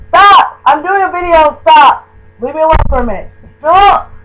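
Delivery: loud, high yell to leave her alone; shouting at family. Real, not acted. Girl shouting at family interrupting her video, loud and high.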